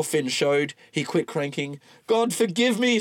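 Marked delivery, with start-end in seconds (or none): 2.1-3.0 character voice. Acted. Walton's confession.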